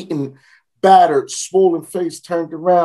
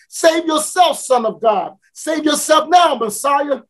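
high jeering shout, pushing on each save; high mocking taunt; 6 semitones higher than usual. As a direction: taunt him to come down. Taunting at the crucifixion.

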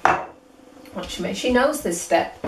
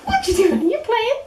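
squeaky dog voice. Acted. High calling to puppy.

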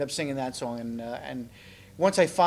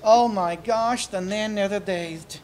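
higher, slow campy diva voice; 7 semitones higher, 5 dB louder, slower than usual. Pretend. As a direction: purr it like an aging starlet. Diva puppet persona.

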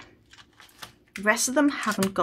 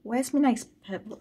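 ordinary delivery

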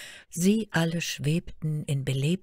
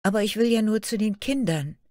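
put-on higher, hurried girlish plea; pleading; 7 semitones higher, 4 dB louder, faster than usual. A narrator's reading. Fairy Tale begs the guards for entry, pleading. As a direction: beg to be let through. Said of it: "bat Märchen".

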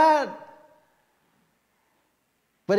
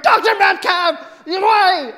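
high, loud, exasperated appeal; angry plea; 11 semitones higher, 11 dB louder, slower than usual. Retold or imitated. Patient mad at the doctor, loud exasperated plea. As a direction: shout at the doctor in frustration.

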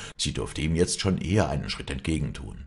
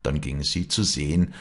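plain reading voice

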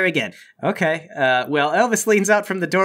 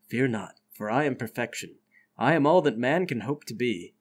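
low, soft, grandiose proclamation; solemn self-proclaimed perfect being; 6 semitones lower, 5 dB quieter than usual. Acted. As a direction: declare your perfection quietly, in a lowered voice. Game master voices nude man.

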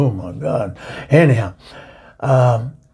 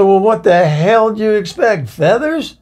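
sassy comeback; 8 semitones higher, 3 dB louder than usual. Retold or imitated. Retorting to the Englishman.

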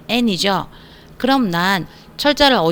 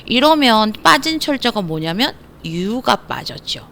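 ordinary talk

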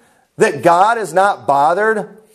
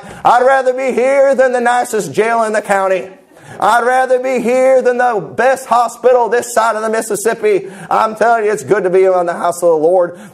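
mock camp meeting preacher. Shown as a demonstration. Preacher mocking camp meeting revivalists for his congregation, hammed-up preaching. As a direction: parody a fired-up camp preacher working the crowd. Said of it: "I could preach like that all night".

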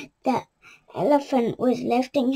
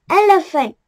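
ordinary delivery, nothing marked

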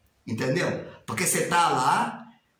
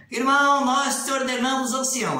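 ordaining elder; 5 semitones higher than usual. Retold or imitated. Retelling the elder's mistaken ordination, lightly intoned and raised. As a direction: proclaim it like the elder at the altar. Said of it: "ele falou ancião".

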